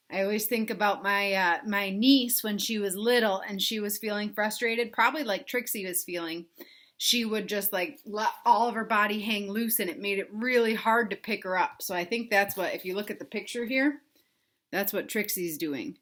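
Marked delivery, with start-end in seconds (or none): none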